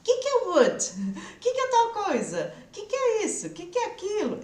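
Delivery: high, pushy questioning voice imitating a curious guest; nosy party guest. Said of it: "sempre alguém perguntando alguma coisa do tipo".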